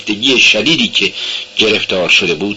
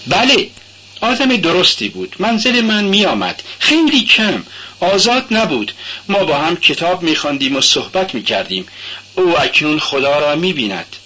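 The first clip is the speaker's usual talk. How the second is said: put-on high, young girl's voice, earnest; young girl protesting she does go to church; timid Sonia; 7 semitones higher than usual. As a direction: answer shyly but eagerly. Sonia speaks of Elizabeth.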